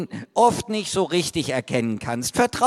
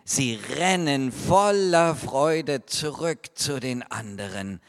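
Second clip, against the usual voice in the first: ordinary talk, nothing marked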